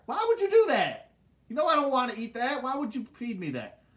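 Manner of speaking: high, retold complaint starting loud; indignant protest. Kid confronting mom over chicken heart trick, indignant.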